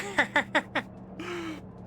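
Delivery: high, choppy cackle; real high laugh in quick bursts; self-mocking laugh. Real, not acted. Laughing at sounding like a boomer, high cackle.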